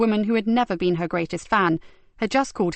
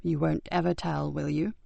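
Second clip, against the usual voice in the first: hushed; 3 semitones lower, 6 dB quieter than usual. Acted. Sleepy boy confiding.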